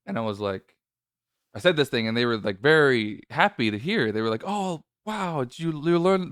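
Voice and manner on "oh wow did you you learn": surprised. Retold or imitated. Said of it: "very surprised and very happy".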